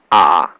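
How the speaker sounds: A dipping low; third tone. Shown as a demonstration. Teacher reading the tone table, low dipping A. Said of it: "Third tone is going down".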